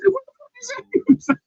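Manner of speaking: high stunned exclamation; shocked reaction. Retold or imitated. Host retells his own reaction to the meme. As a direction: jump up in pitch, baffled. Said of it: "I said".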